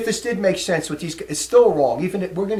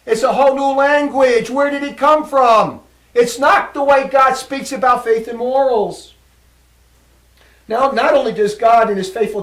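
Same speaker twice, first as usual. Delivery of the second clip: loud, higher, insistent declaration; emphatic preaching; 5 semitones higher, 8 dB louder than usual. Real, not acted. Preacher insisting to his folks it's real, loud and fervent. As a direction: hammer it home to the folks.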